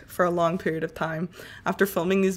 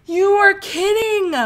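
loud, high and slow; can't believe it; outraged disbelief; 12 semitones higher, 6 dB louder, slower than usual. Real, not acted. Player protests the game's result, high and loud. Another failed attempt.

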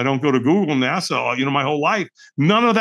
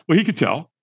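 ordinary talk

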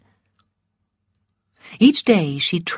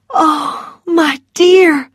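high, loud cry of sympathy; distressed cry; 10 semitones higher, 5 dB louder, much slower than usual. A narrator's reading. Melanie reacts to Missus Meade's loss, crying out. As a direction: cry out to a grieving friend. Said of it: "cried Melanie".

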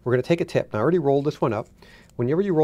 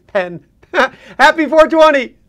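giggling, loud and high; laughing loudly; 14 semitones higher, 14 dB louder than usual. Acted. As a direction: crack up through the sign-off. Stoner segment wrap-up, laughing.

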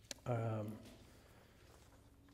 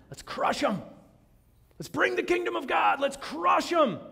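high, loud rallying shout; zealot battle cry; 13 semitones higher, 4 dB louder than usual. Retold or imitated. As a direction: rouse the freedom fighters to war.

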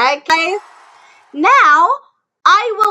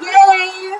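cheer; 5 semitones higher, 3 dB louder than usual. Real, not acted. Cheering student's answer.

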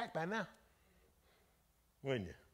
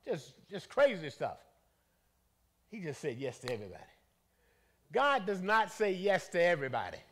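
usual speaking voice

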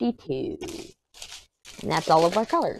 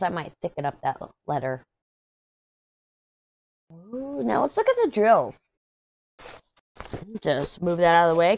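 normal talking voice

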